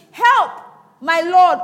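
high, loud plea; crying out for help. Acted. Hungry woman calls to passing king, loud cry. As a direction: call up to the king from afar. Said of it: "there cried a woman unto him".

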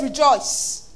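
ordinary talk

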